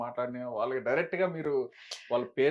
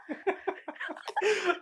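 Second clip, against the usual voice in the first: burst of laughter. Real, not acted. Interviewer cracking up at the guest's rant, high burst of laughter. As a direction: crack up at the punchline. Interviewer hears the slang line.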